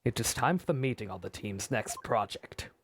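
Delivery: a hint of a deep man; lightly voiced stern colleague; low male voice. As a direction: drop low for him. Voicing Hubert, a male character.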